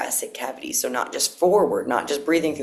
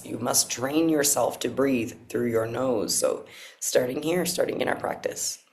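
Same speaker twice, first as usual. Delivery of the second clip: guided breathwork. Acted.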